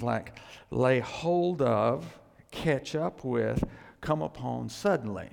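slow, weighty, every word leaned on; measured teaching pace; slow emphatic definition. Shown as a demonstration. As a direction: spell out the meaning slowly.

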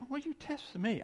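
high half-voiced knowing rebuke; see through them calmly; Jesus knowingly. Jesus to the hypocrites, high knowing rebuke. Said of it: "He said".

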